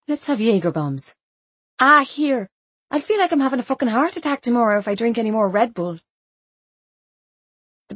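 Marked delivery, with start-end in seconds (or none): 1.8-6.0 quick, raised half-laughing complaint; fast exasperated protest. Acted. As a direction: rattle it off in protest. Protesting drinks, fast and high.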